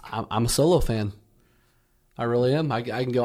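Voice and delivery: ordinary talk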